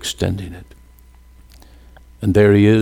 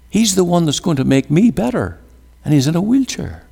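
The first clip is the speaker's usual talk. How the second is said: high, quick, mock-shocked disbelief; incredulous; 9 semitones higher, faster than usual. Patient recalling the doctor who came in a wheelchair, incredulous. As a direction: retell your own astonishment, pitched up and hurried.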